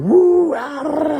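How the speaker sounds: loud, high mock whoops of a hyper toddler; hammed-up toddler whoops, very high; imitating kid's whooping. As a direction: parody his wild late-night yelling. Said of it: "down here going".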